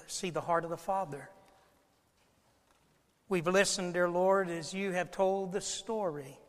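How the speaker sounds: earnest pastoral prayer, swelling on Lord. Acted. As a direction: pray over the congregation with feeling. Closing prayer.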